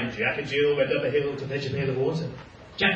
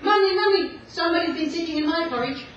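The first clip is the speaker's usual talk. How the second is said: put-on high, fast little-child voice; little baby bear; 11 semitones higher, 3 dB louder than usual. Baby bear calling to his mummy, high childish squeak. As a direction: squeak it like a tiny bear cub. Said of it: "little baby bears say".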